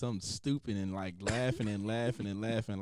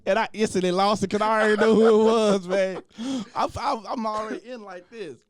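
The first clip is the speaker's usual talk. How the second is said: laughing excitedly; 8 semitones higher, 7 dB louder than usual. Real, not acted. Recalling laughing fit.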